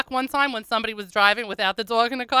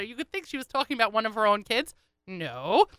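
ordinary talk

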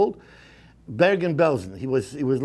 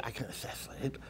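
whisper; 3 semitones lower, 19 dB quieter, faster than usual. Acted. Dream of stopping the war.

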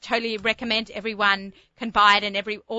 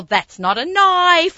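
slow, higher mock Aussie drawl; Crocodile Dundee quote; 9 semitones higher, 5 dB louder, much slower than usual. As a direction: ham up the Aussie movie line slowly. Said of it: "how many times people have said".

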